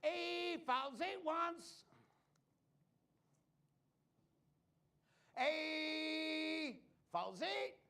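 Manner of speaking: high, slow hammed-up Cockney Londoner; mock Cockney accent. Magician mocking his own trick, slow high fake Cockney accent. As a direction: stretch it out in a silly Cockney accent. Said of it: "performing this with a British accent".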